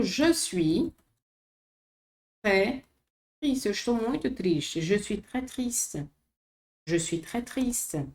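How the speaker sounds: ordinary talk, nothing marked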